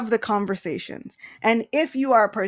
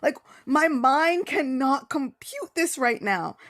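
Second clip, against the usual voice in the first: overwhelmed and baffled; 5 semitones higher, slower than usual. Retold or imitated. Arjuna overwhelmed by seeing God.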